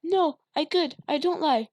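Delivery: put-on high, loud scared child voice; girl pleading with father; frightened little girl. A narrator's reading. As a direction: plead in terror.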